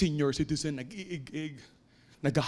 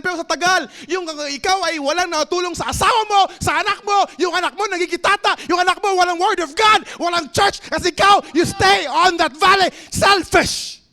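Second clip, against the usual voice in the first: loud, rising preacher's cry, louder each line; fiery preaching; 7 semitones higher, 7 dB louder than usual. Acted. Pastor preaching to the congregation, full-force shouting.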